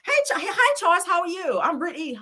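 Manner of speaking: imitating an excited woman meeting Charles; mock eager greeting. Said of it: "We was like".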